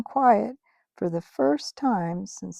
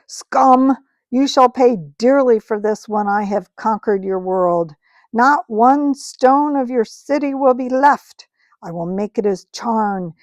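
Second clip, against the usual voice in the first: loud ringing witch voice, almost happy; a queen vowing ruin on the crowd; loud, triumphant evil queen; gleeful witch threat; 3 semitones higher, 10 dB louder than usual. Acted. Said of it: "the voice of the witch, clear like a bell".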